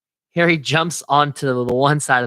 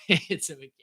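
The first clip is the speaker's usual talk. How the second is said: ordinary delivery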